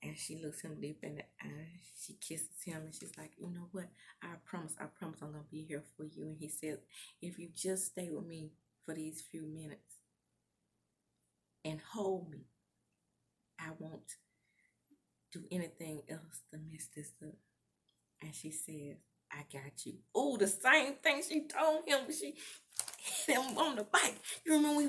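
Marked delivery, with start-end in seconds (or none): 3.4-6.2 soft, intimate imitated reassurance; tender promise. Retold tender promise to lover. 7.2-17.3 soft, low, intimate retelling; hushed lover's vow with long pauses; tender plea. Retold or imitated. 19.3-20.0 very soft, low imitated reply; hushed and intimate; tender reassurance. Retold reassurance to lover.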